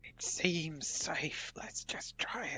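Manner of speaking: hushed breathy whisper near the bear; stage whisper. Real, not acted. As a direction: whisper so the bear stays asleep. Sneaking past a sleeping bear.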